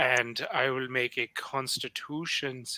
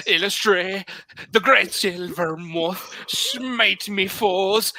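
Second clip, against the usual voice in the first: higher, louder Irish prayer, slow at the end; Irish accent; 6 semitones higher, 4 dB louder, slower than usual. Acted. Cleric calling on Illustre to smite his foes in an Irish accent. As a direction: call on the moth god in a grand Irish accent. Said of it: "stay Irish and not Scottish".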